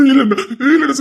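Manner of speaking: sob-laugh protest. Acted.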